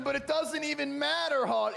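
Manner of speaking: loud, high hype call; excited. Real, not acted. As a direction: shout it over the action. Hyped call, line repeated.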